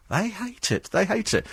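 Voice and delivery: high, soft singsong taunt; needling, high-pitched aside; gleeful jibe. Real, not acted. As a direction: drop the volume and lift the pitch, relishing it. Gleeful jibe at cab drivers.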